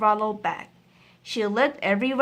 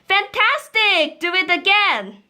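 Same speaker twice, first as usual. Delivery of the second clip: shouted; 10 semitones higher, 6 dB louder than usual. Acted. Princess shouting at the tired dragon, gushing cheer. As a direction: cheer him on to wear him out. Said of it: "Elizabeth shouted".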